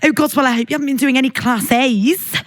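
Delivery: put-on Scouse, mock serious; hammed-up Liverpool accent; Scouse mum. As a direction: imitate a Scouse mum checking up on her daughter. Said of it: "I'm doing Scouse accent now".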